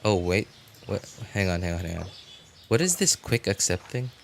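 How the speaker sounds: normal talking voice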